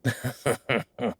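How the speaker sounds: short chuckle in separate bursts; amused chuckle. Acted. Clerk to visitor about moving for a chimp, amused chuckle.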